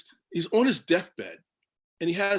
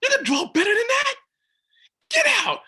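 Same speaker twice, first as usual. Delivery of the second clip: very high, louder mock astonishment; hammed-up gasp of disbelief at the children; 6 dB louder than usual. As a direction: tease the kids with over-the-top shock. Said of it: "I'm like".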